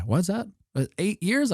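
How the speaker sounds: mock official playing dumb; high feigned innocence. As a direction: dodge the question sweetly.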